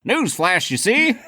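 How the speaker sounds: high, bright newsreel announcer; old-timey newsreel announcer. Pretend. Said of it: "like, old-timey newsreels".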